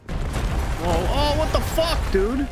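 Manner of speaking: sudden high, loud surprise; real shock at an unexpected jump; startled outburst. Real, not acted. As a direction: caught off guard, blurt it out. Startled by sudden game event.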